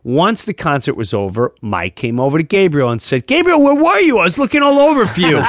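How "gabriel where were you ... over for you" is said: excited. Retold or imitated. Retelling Mike's eager greeting.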